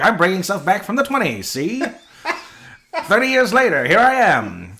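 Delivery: high, loud hammed-up 1920s voice; old-timey grandpa. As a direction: play grandpa bragging from the past. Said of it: "He's like".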